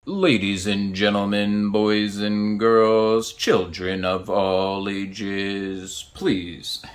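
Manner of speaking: booming, slow showman announcement; ringmaster. Pretend. Mock circus-style show opening.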